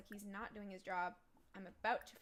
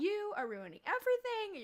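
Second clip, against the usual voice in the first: mock-angry mom, much higher and louder; 10 semitones higher, 9 dB louder than usual. Mother scolding her son for breaking up, loud and angry. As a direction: scold him like a mother whose plans he ruined. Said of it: "And she'd be like".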